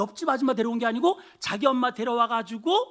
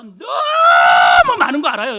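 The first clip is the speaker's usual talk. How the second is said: slow, tearful shout, much louder; crying shout; 3 semitones higher, 17 dB louder, slower than usual. Real, not acted. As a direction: cry it out from the chest. Preacher's emotional outburst. (울먹이며 크게 외치는 설교)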